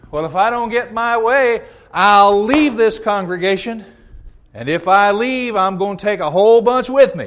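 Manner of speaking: bully them with leaving; hammed-up member threatening to walk out; mock threat. Acted member threatens to leave.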